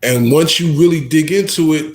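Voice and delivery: normal talking voice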